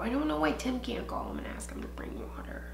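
whispering a complaint to herself; hushed sulky aside; breathy whisper of annoyance; whispered grumble. Real, not acted. Grumbling about husband.

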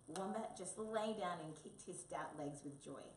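plain reading voice

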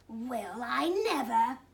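sniffly wounded indignation; offended sniffle. A narrator's reading. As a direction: huff it out, mildly affronted. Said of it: "she sniffled".

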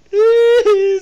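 high, loud squeal; mock frightened squeal. Alone in the dark game, high mock squeal.

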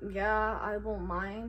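ordinary talk

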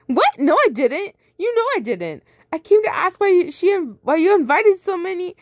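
frantic, high denial; high panicked protest. Acted. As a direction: deny it frantically.

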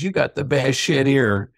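mock Kentucky drawl; heavy Kentucky accent. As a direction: stretch it out like a Kentucky dad. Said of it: "he's got a heavy Kentucky accent".

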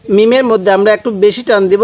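ordinary delivery

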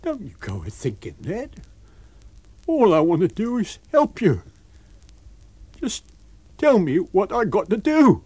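high-pitched, loud pleading; terrified tramp. A narrator's reading. Tramp begging to help his invisible master, terrified. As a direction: protest loyalty in a high, frightened voice. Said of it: "gave a yelp of terror".

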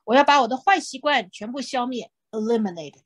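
normal talking voice

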